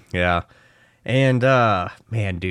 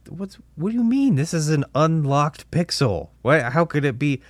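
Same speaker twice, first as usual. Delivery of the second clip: incredulous; 6 semitones higher than usual. Retold or imitated. Retold disbelief at the app.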